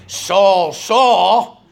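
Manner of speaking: the voice of God calling Saul, loud and insistent; emphatic name call. Retold or imitated. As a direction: call his name out with force, twice. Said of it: "But when you say the whole name".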